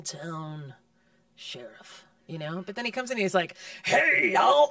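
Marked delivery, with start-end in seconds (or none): 3.8-4.7 loud, high mock greeting; imitated hearty greeting. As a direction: burst in with a hammed-up hello. Mimics Denzel's entrance in the film.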